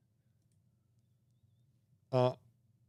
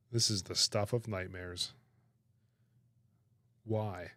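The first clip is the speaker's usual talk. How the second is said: usual speaking voice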